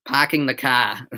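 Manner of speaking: Boston accent, r's dropped: "parking" as "pahking", "car" as "cah". Shown as a demonstration. Streamer teasing chat in a Boston accent. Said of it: "Boston accent".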